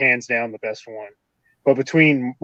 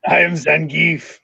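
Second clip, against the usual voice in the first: put-on Russian accent. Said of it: "you can do a Russian accent".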